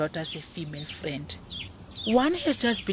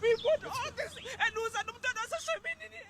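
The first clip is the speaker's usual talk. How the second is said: high wail trailing off softer; distraught wail fading; 6 dB quieter than usual. Acted. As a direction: let the anguish drain away, faster. Mother's outburst fading.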